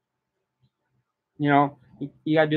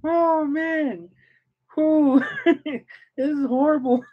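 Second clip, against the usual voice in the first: high, breathless laughing exclamations; can barely speak for laughing; crying with laughter; 10 semitones higher, slower than usual. Real, not acted. Reacting to the turkey story, crying with laughter. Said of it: "I'm still crying over here".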